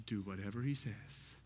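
soft, low, intimate "Do whatever he says."; soft, low retake. Shown as a demonstration. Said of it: "His mother says to the servants".

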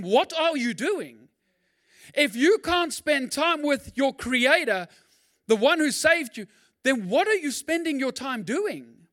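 rising sermon fervour; preaching. Acted. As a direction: press the congregation hard from the pulpit. Preaching climax, raised voice.

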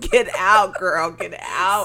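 loud, slow plea through laughter; mock warning shout. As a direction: holler at the heroine on screen. Calling out warning to movie heroine.

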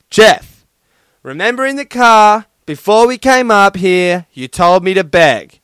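loud, high-pitched exasperated outburst; exasperated child. Acted. Little sister throwing it back at her brother, exasperated shout. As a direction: throw it back loudly as a fed-up little girl. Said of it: "in exasperation, exasperation, she threw back at him".

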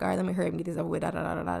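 very fast, gabbled mock rush; rushing through it. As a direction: rattle it off to get it over with. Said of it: "so you just kinda like".

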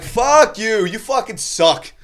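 high, drawn-out jeer; retold heckling yell. Drunk heckler defending best friend, high angry jeer. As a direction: yell it up at the stage, stretching the insult.

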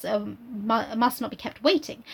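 ordinary talk

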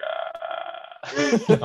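long high strained wail; dying groan. Pretend. Friend shows how her grudge noise sounds, drawn-out dying wail. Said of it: "Mine just sounds like I'm dying".

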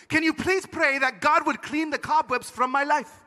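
put-on high, slow, accented entreaty; old lady. Old lady begging prayer against cobwebs, high slow plea. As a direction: plead slowly as an accented old woman. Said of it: "one very old lady".

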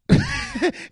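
sudden high cackling laugh; high incredulous laugh; burst of laughter. Real, not acted. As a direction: laugh out loud at the absurdity. Laughing at the absurd scene.

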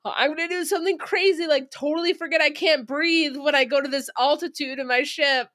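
high, fast sarcastic imitation; mocking hero voice. Reviewer mocks the pilot's reckless line, high, fast and sarcastic. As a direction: parody his bravado quickly. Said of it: "you're like".

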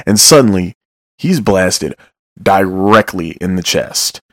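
ordinary delivery

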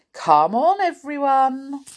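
storybook leader calling friends along. Acted. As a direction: round everyone up brightly. Saucepan calls friends off train.

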